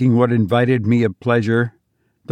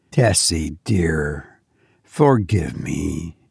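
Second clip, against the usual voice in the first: tender plea; 6 semitones lower, slower than usual. Acted. Narrator soothing sobbing Tessie.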